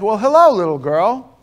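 put-on high, loud, sing-song hello to a child; booming hammed-up hello; cheesy stranger greeting. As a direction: greet her like an overly friendly grown-up. Man greets girl on plane.

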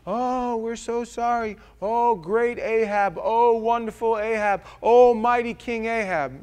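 high, loud, drawn-out grovelling praise; fawning flattery. Acted. Servants grovel before King Ahab, high hammed-up flattery. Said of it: "he goes out and he goes".

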